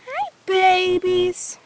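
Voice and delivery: ordinary talk, nothing marked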